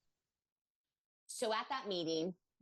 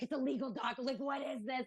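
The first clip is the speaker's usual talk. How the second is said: mildly panicked, pitched-up retelling; a touch of alarm, voice raised in pitch; freaking out; 7 semitones higher than usual. Retold or imitated. Parent facing an IEP meeting, mildly freaking out. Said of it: "I'm, like, freaking out".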